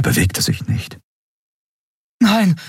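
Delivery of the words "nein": high-pitched gasped cry; horrified gasp. A narrator's reading. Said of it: "keuchte Endriel und rannte". (entsetztes, hohes Keuchen)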